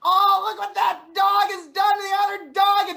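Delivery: loud, high burst of dog-owner alarm; excited outburst. Acted. Dog owner yelling across the park, high and loud.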